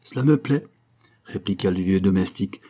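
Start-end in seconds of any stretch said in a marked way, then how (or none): 0.0-0.7 high-pitched pleased reply; gleeful, raised-pitch remark; joyful satisfaction. A narrator's reading. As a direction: show undisguised pleasure at the news. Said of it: "en manifestant toute la joie".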